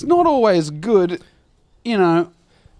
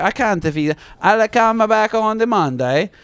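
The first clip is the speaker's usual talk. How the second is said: hammed-up Italian plumber; Italian accent; 4 semitones higher than usual. Italian plumber telling tenant he can't fix it, mock accent. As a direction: imitate an Italian handyman making excuses. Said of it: "He's Italian".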